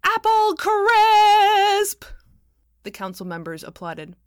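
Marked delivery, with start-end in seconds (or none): 0.0-2.0 put-on high, drawn-out child's bellow. A narrator's reading. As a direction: belt it out like an excited little girl. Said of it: "and bellowed".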